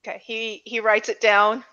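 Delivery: usual speaking voice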